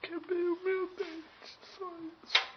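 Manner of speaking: squeaky high mock whine; high silly voice. Real, not acted. Drunk man mocking himself. (voz aguda de brincadeira)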